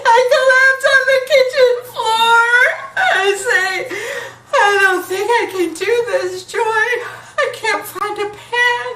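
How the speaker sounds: high, loud half-crying, half-laughing shouts; hysterical laugh-crying. Real, not acted. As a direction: come apart on the kitchen floor. Collapse, can't find pen.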